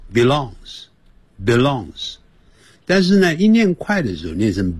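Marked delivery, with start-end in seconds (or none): 0.1-2.2 belongs 慢慢念清楚每个音; slow careful belongs. Shown as a demonstration. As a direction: say belongs slowly, every sound clear. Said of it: "我们的发音会给你念成". (放慢念 belongs 两遍)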